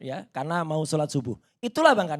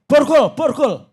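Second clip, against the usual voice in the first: loud, high barked command; teriak; 7 semitones higher, 8 dB louder, slower than usual. Retold or imitated. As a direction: imitate an officer yelling for handcuffs. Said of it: "Bahkan orang ini teriak". (meniru teriakan perintah, keras dan tinggi)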